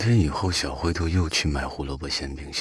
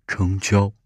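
quick burst of glad agreement, a bit louder; happy; 5 dB louder than usual. A narrator's reading. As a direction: seal the deal happily. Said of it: "小灰兔开心地".

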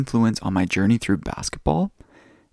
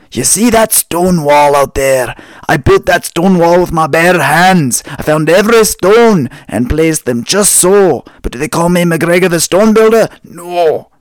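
put-on loud, higher old Scottish barman brogue; Scottish accent; 7 semitones higher, 10 dB louder than usual. Old Scot boasting to a young tourist, loud Scottish accent. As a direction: bellow it like a proud old Highland Scot. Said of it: "the old Scottish guy".

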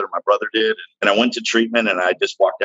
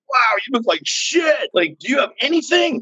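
mocking older man; 13 semitones higher than usual. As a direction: imitate a man jeering in high-pitched amazement. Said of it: "he started laughing at me".

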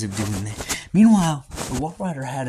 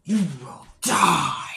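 menacing witch voice; 3 semitones higher, much slower than usual. Acted. Witch threatens her enemy.

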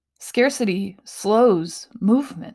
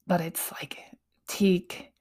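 whisper; 2 semitones lower, 6 dB quieter than usual. Real, not acted. Dreamy aside about piano.

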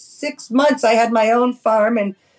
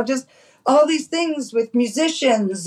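normal talking voice

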